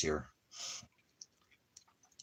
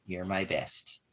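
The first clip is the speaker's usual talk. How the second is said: ordinary delivery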